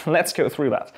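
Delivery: ordinary delivery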